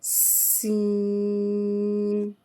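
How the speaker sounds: सीन with a long hissing whistled s; सीन drawn out with a sharp hiss; whistling s. Shown as a demonstration. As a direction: stretch सीन, letting the s whistle like a flute. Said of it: "इसकी sound भी किस तरह सीटी की तरह निकलती है".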